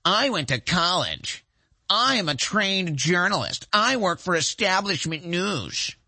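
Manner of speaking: slow, smug mock of an entitled journalist. Mocking a journalist's entitled whine to his audience, slow and smug. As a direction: sneer it out like a self-important reporter. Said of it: "this is what the leftist liberal entitlement is all about".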